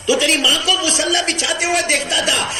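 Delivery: high-pitched, accusing sermon voice; impassioned reproach. Acted. God reproaching one who skipped prayer, high impassioned preaching.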